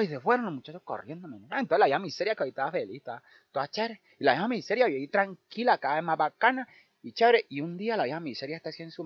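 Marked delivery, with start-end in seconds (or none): none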